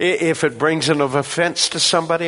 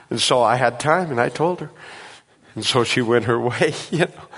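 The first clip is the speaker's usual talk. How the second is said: ordinary talk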